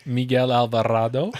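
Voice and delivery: put-on "Miguel Alvarado" in a light Spanish accent. Host lightly showing off a Spanish accent on a guest's name. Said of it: "[name] has to say it in that accent".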